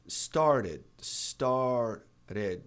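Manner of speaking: "started" with the middle T tapped, like "starded"; "started", the T flapped. Shown as a demonstration. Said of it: "Começar".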